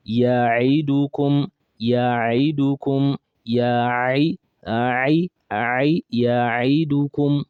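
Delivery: Ya'idukum drawn out, Ain from the throat; slow teaching demo. Shown as a demonstration. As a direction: model Ya'idukum slowly for students. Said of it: "Repeat after me".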